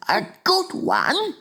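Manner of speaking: high, slow, incredulous giant; excited cry. A narrator's reading. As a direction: cry out in amazed delight. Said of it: "he cried".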